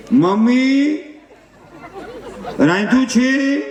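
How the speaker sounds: high, slow lazy ask; mock daughter-in-law. Daughter-in-law asking mother-in-law if food is ready, high and slow.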